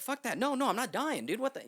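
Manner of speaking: high, loud outburst; retold frantic protest; panicked defiance. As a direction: refuse loudly, in a rush of panic. Refusing to die in panic.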